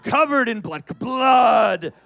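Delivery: loud, high, drawn-out shout; loud excited yelling. Real, not acted. Actor shouts to the crowd about blood everywhere. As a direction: yell each blood like it won't stop.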